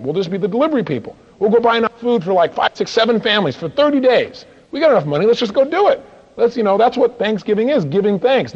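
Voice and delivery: high, loud and fired up; rally the partners with eager energy; excited pitch. Retold or imitated. To his partners, pushing to feed Harlem, excited rallying push.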